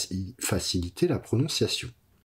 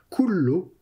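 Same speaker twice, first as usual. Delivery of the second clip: "kullo" jumps up high and louder; emphatic high kullo; 11 semitones higher, 4 dB louder than usual. Shown as a demonstration. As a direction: lift "kullo" up to highlight it. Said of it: "Uvrechalo est dans son palais". ("kullo" accentué, plus haut)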